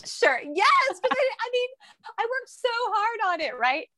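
squeaky sarcastic yes; mock agreement, very high. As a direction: pitch way up in sarcasm.

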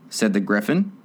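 ordinary delivery, nothing marked

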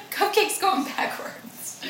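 slow hushed whisper. Real, not acted. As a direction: breathe it out quietly.

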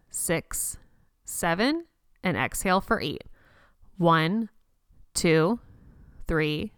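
slow counting with long pauses, some counts breathed out; walk them through each breath; steady breathing coach counting aloud; guided breath count. Acted. Leads the breathing rounds again.

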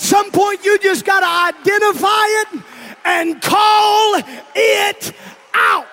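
rising preacher's call; preaching. Acted. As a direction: preach it with building force. Preaching at full force.

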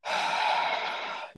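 drawn-out breathy sigh; long sigh. Real, not acted. Pausing mid-discussion of being irritated, a long sigh. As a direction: let out one long exhale. Long exhale after joke.

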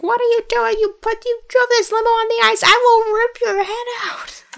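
high, furious screeching at the driver; angry movie character. Acted. Furious woman at the limo driver, high screech. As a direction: shriek at him like a diva in a fit.

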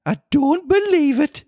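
high falsetto, breaking into laughter; hammed-up Geordie pigeon scoff; disbelieving pigeon. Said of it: "said the pigeon".

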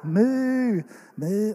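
cow moo. Retold or imitated. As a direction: moo for the baby. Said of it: "Is there a cow?".

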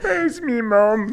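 high, very slow northern wail, laughing; mock northern accent. Mocking how they talk, laughing northern accent. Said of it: "say weird things like".

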